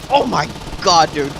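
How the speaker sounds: ordinary talk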